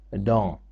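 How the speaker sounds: "Dans" with the final s left silent. Shown as a demonstration. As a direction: say "Dans" without its last s. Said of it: "Літера С на кінці не промовляється".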